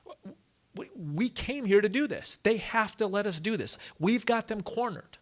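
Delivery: high-pitched, stammering protest; indignant protest. Acted. Followers objecting to the Prophet's retreat, indignant.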